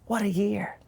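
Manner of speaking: breathy whispered aside. Real, not acted. Whispering an aside to the viewers. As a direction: drop to a whisper for the joke.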